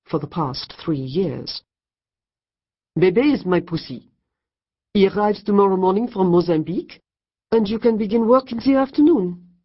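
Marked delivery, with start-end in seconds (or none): 3.0-9.6 put-on louder, rising French accent. A narrator's reading. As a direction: announce it grandly in French tones. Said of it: "Mirabelle Moncur filled in".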